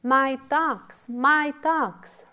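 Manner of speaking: high, loud cry of possession; shouting. A narrator's reading. Dull-witted man chasing the wild ducks, shouting.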